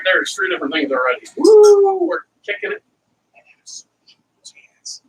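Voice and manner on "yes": breathless soft whisper of triumph; whispered yes. Real, not acted. Cook celebrating quietly to himself, whispered yes. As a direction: whisper it to yourself.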